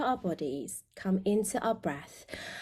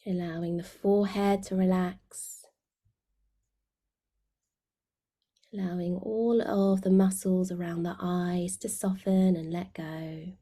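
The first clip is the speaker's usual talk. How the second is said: guided meditation. Real, not acted. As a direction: guide them gently inward, letting phrase endings sink away.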